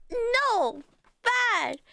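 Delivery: imitating toddler nephew. Nephew hiding behind his mother scolding the big kid, high toddler imitation. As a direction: point and scold like an indignant toddler. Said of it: "points at the bigger kid and go".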